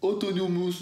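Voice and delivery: autonomous, a stumbling high-pitched try at an English accent; English accent attempt. Shown as a demonstration. Vlogger tries the English term, botched English accent. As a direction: fumble the word autonomous in a put-on English accent. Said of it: "attention, l'accent".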